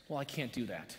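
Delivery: ordinary talk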